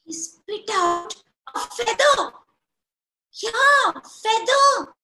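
loud, high-pitched storyteller announcement; excited telling. A narrator's reading. Storyteller to children, loud and high with excitement. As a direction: make the children gasp at it.

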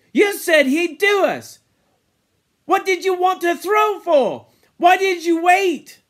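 loud, high, angry challenge; heated accusation. Acted. Ralph challenging Jack over the boar, angry shout. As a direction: fling the questions as accusations.

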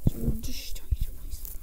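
breathy whispered plea; whispered please. Real, not acted. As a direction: beg under your breath. Whispered plea while hiding.

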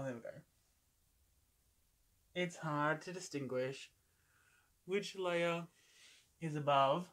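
talking while adjusting mask; wearing sheet face mask. Real, not acted. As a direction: hands near mouth. Seen 0: Printed sheet mask on face throughout; hands adjusting it near mouth.

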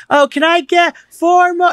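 high, slow call over a loud bar; calling to the bartender over a loud room; yelling over noise. Retold or imitated. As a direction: shout the order across the bar. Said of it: "I had to, like, scream".

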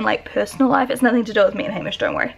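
ordinary talk

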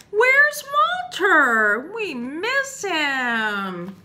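loud, very high, drawn-out call; big storybook wail of longing; wondering, calling out. Acted. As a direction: call out slowly for someone missed. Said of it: "started to wonder".

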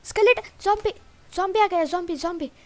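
high, loud cry of alarm; panicked shout. Real, not acted. Gamer spotting skeleton and zombie in the dark, panicked shout. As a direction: yell out a sudden warning. Gamer spots attacking mobs.

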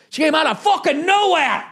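disbelieving yell; exclaimed repeat. Shown as a demonstration. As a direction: blast the repeat like a punchline.

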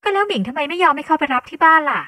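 loud, high-voiced reproach; high-pitched scolding. Colleague chiding her close junior, high and loud. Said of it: "เจแอนพูดเสียงสูงต่อว่าน้องสาวคนสนิท".

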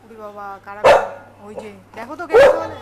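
put-on loud, playful who's-that to distract a child; loud pretend surprise at a noise; mock alarm.

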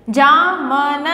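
usual speaking voice

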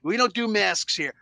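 blustering wrestling boss; Vince McMahon. Acted. Boss objecting to masks among his wrestlers, mock blustering. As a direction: put on an indignant boss, leaping high on the whoas. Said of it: "Let's do my Vince McMahon voice".

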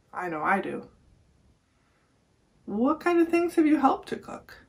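ordinary delivery

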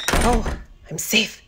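usual speaking voice

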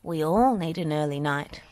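ordinary delivery

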